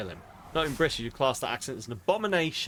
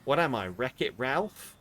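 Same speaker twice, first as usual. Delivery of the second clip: ordinary talk, nothing marked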